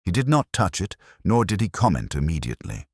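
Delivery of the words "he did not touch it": usual speaking voice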